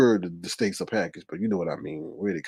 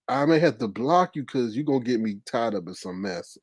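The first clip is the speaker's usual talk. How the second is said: ordinary talk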